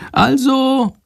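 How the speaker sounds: very high figure voice; chatty Sándor, resuming. A narrator's reading. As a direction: pick up the tale in a high voice. Sándor resumes his account. (sehr hoch zum Erzählen ansetzen)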